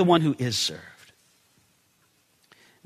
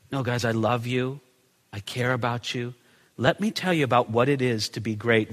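softer, tender voice for Jesus; gentle reassurance; 7 semitones lower, 6 dB quieter than usual. Retold or imitated. Jesus gently telling disciples he loves them, soft and tender. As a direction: reassure them softly, with love. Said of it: "Instead, he says".

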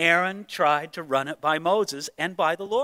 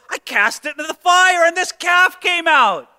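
higher mock-innocent excuse; lame excuse; 8 semitones higher, 4 dB louder than usual. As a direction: play dumb with a straight face. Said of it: "the lamest of excuses".

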